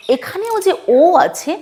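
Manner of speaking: usual speaking voice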